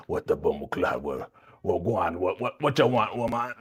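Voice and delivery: hammed-up Jamaican patois; mock a terrible Jamaican accent; bad Jamaican accent. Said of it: "Tell me his accent wasn't terrible".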